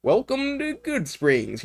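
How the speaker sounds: high-pitched, silly cowboy robot greeting. Retold or imitated. Cowboy robot welcoming someone to Good Springs, silly high voice. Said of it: "very silly voice".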